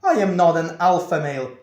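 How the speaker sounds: retold shock at full volume; shocked outburst. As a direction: blurt it out in appalled disbelief, stretching the first words. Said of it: "I was shocked".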